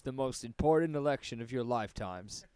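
ordinary talk, nothing marked

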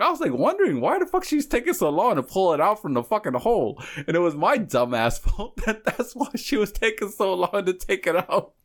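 high-pitched, laughing retelling; real amusement. Real, not acted. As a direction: crack up while confessing the blunder. Cracking up at his own mistake.

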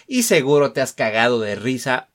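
usual speaking voice

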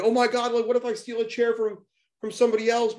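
high, mock-panicked imitation; imitated freak-out. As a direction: play the kid freaking out over a seat. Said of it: "he just starts freaking the fuck out".

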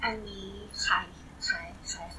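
very soft, voiceless whisper; whispered aside. Real, not acted. Co-host quietly asking who this is, whispered aside. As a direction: whisper it off to the side. Off-mic aside during the sponsor read.

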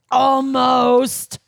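loud, drawn-out, level-pitched word; hammed-up title read. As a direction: belt it out like a headline. Reading review title, drawn-out word.

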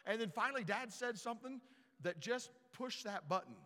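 ordinary talk, nothing marked

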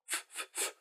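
voiceless f, f, f, breathed softly; whispered f sound. Shown as a demonstration. Teacher breathes the f sound for learners. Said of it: "wir sprechen einen F-Laut".

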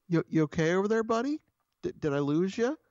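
high sing-song pet-talk imitation. Business partner checking on a silent client, mock pet-talk. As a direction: mimic cooing at a pet, pitch climbing to "buddy". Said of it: "talking to people like they're, like they're pets".

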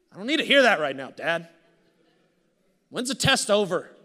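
sudden loud, high exasperated protest; a son crying out to his father, exasperated; exasperated outburst. Retold or imitated. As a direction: snap back at dad, fed up and pleading. Frustrated retort to dad retold.

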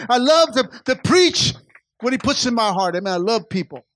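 loud, high, driving preacher's cry; full-force preaching. Acted. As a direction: preach it out with all your fire to the church.

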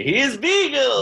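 thrilled, high-pitched reveal; excited. Real, not acted. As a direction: announce it with delight. Excited recognition of actor.

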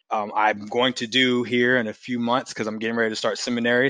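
ordinary talk, nothing marked

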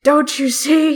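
put-on loud, high raspy old man. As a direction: scold like a grumpy old man. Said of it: "raspy old man voice".

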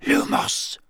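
breathy unvoiced whisper. A narrator's reading. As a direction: whisper the spell. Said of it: "Harry whispered".

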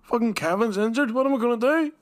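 high panicky put-on voice; panicked manager. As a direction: whine it like a stressed coach. Mimicking a panicking football manager.

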